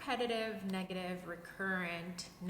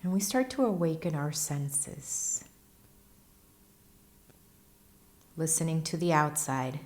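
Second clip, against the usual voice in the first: guided meditation; 2 semitones lower, 10 dB louder than usual. Acted. Teacher leading listeners into breath and stillness, guided meditation voice. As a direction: guide them unhurriedly through posture and breath, evenly paced.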